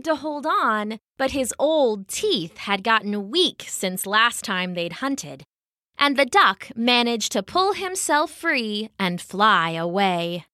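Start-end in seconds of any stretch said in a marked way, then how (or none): none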